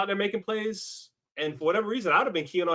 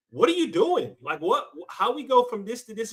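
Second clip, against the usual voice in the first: higher-pitched, baffled questioning; exasperated disbelief; 6 semitones higher, 3 dB louder than usual. Acted. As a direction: demand an explanation, mock-exasperated. Imagined baffled reaction to the player.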